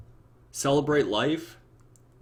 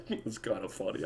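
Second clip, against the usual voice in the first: much softer, reluctant admission; grudging mumble; 10 dB quieter than usual. Pretend. God quietly admitting the prank is funny, grudging mumble. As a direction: concede it under your breath. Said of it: "And he's like".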